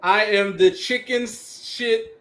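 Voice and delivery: heel promo. Acted.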